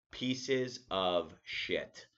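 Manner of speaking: "pieces of shit" dragged out very slowly, each word weighed; slow emphatic insult. Real, not acted. Insulting National Grid.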